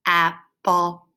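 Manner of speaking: "apple" in two level, separate parts; chin-check syllables. Shown as a demonstration. Teacher feels breaks under chin, "apple" in two parts. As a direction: split "apple" evenly, jaw dropping on each part. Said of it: "Under our chin".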